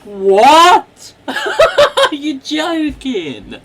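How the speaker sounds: loud, high, laughing disbelief; stunned laugh. Real, not acted. Hearing the nurses are long-lost sisters, laughing in disbelief. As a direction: blurt it out, laughing in shock.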